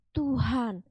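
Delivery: usual speaking voice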